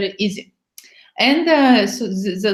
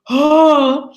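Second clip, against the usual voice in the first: sharp loud gasp of surprise, a touch higher; shocked gasp; 5 semitones higher, 5 dB louder than usual. Shown as a demonstration. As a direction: gasp out like a startled man. Demonstrating the man's shocked reaction.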